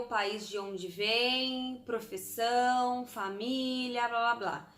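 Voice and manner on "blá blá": usual speaking voice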